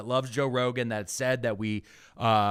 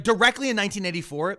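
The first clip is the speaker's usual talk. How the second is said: ordinary delivery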